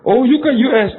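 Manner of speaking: high, loud mock awe; impressed lady. Church lady gushes at a friend, high and loud. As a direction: gush at the US trip.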